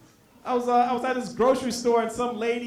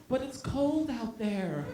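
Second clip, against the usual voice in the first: small, slow, soft plea; mock-pitiful, drawn-out softness; pleading stranger; 9 dB quieter, slower than usual. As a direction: whine it gently to win sympathy. Acted plea of stranger in car.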